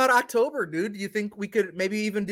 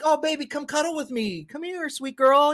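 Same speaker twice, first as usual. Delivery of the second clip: cooing dad; 8 semitones higher than usual. Retold or imitated. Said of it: "I'll be like".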